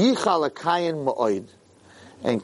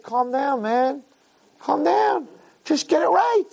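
much higher, soothing but firm; God calming; 11 semitones higher, slower than usual. Acted. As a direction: settle him down and set him straight.

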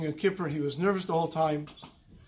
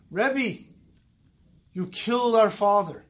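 higher, louder accusation; accusing outburst; 4 semitones higher, 5 dB louder, slower than usual. Retold or imitated. Grieving son accusing the Dayan at the shiva, angry outburst. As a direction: hurl the blame at him. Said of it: "this one brother's outburst".

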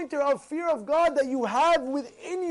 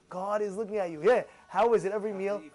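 normal talking voice

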